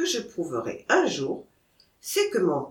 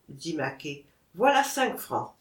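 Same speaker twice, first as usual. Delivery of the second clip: usual speaking voice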